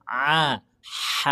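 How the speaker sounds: "A" bersuara lalu "H" desah, keduanya dari tenggorokan; ain then ha. Shown as a demonstration. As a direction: bandingkan ain dan ha. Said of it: "Kalau huruf ain". (ain lalu ha)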